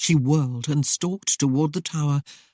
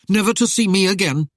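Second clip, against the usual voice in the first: louder, fast pressing question; demanding; 4 semitones higher, 6 dB louder, faster than usual. A narrator's reading. Christopher pressing Arienne, louder and fast. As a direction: push her for the truth. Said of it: "he demanded".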